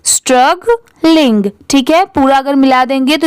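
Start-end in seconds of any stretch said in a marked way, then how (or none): 0.0-1.4 slowed repeat. Shown as a demonstration. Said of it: "तो पूरा क्या हो जाएगा".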